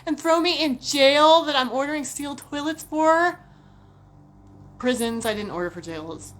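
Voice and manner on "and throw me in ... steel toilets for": higher, much louder panicked rant; loud mock-frantic worrying. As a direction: spiral into hammed-up dread. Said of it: "I'm like".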